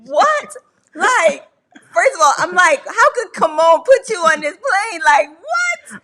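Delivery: high, loud outraged what; shocked disbelief. Retold or imitated. Wife retelling her reaction to the plane, high and loud in shock. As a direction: squeal it in total disbelief.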